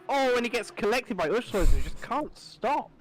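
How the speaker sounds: high, loud excited commentary. Real, not acted. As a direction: call the crash as it happens. Crash unfolding in live commentary.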